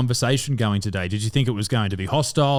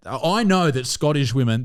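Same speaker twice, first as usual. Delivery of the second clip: usual speaking voice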